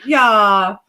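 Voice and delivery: loud, touched outburst rising in pitch; exclaim, moved to joyful near-tears, about to laugh; overwhelmed by affection, high and loud; happy tears. Real, not acted. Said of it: "make me cry happy tears".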